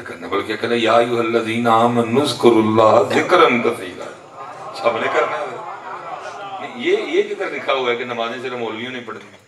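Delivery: indignant preaching. Acted. Preacher disputing that only clerics should pray, indignant preaching. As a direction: challenge the congregation at full force. Preacher challenges listeners at force.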